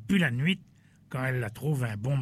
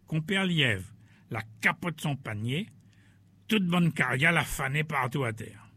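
usual speaking voice

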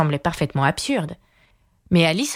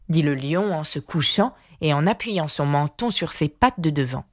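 ordinary delivery

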